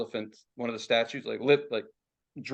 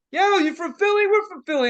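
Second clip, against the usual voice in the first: loud, high-pitched mock enthusiasm; hammed-up eager tourist shout; excited fans; 7 dB louder than usual. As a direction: imitate overexcited strangers spotting fellow fans. Acting out excited fellow Philly fans.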